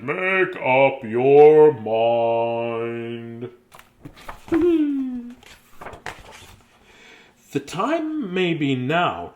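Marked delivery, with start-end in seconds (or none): 0.0-3.5 very slow, low, stretched plea; drawn-out urging. Acted. Child urges himself to decide, very slow and low. 4.0-5.3 laugh. Real, not acted. Laughs after drawn-out line.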